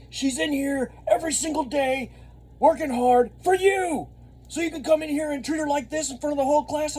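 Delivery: mock yell at a teenager; retold yelling. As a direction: scold the kid in front of class. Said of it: "I start yelling at this kid".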